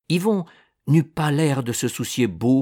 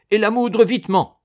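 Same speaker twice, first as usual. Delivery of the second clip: commanding; 9 semitones higher, 8 dB louder than usual. Acted. Ordering the mill.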